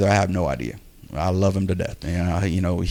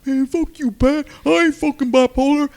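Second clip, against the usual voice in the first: high, hammed-up angry friend, louder; mocking a friend's angry outburst, pitched way up; 4 dB louder than usual. Friend cursing at the speaker, imitated high and angry. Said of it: "And he's like".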